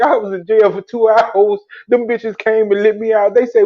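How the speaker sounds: ordinary delivery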